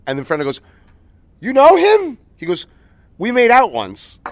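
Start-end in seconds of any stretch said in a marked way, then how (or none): 1.4-2.2 high, loud startled question; surprised. Retold or imitated. Imitating Fernando surprised. 3.2-4.2 blunt reveal. Retold or imitated. Imitating girl's reply.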